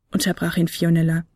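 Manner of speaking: ordinary delivery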